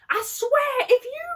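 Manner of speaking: high-pitched, hammed-up shrieking threat; mock screamed warning in a put-on voice; Kelly shouting. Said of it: "she was shouting".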